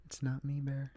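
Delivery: soft, hushed plea; barely above a breath, pleading; talking very quietly. Retold or imitated. Trapped girl quietly pleading with Bear in bed. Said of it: "she's talking very quietly".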